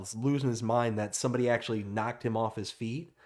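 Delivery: normal talking voice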